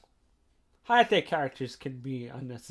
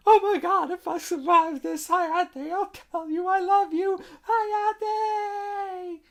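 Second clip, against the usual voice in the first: much higher, loud, desperate yell; panicked shout; 8 dB louder, slower than usual. Retold or imitated. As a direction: scream a love confession as the plane takes off. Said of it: "she basically shouts out".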